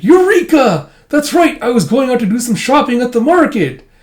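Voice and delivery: high, loud eureka cry; excited old man. Retold or imitated. Old man remembers his shopping errand, high excited cry. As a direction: burst out with discovery.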